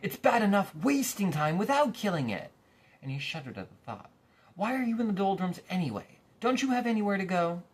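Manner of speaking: loud, high scolding; stern watchdog scolding. Acted. Watchdog scolding Milo, loud stern scolding. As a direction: tell the boy off hard.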